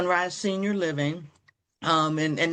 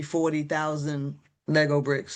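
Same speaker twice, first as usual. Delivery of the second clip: ordinary talk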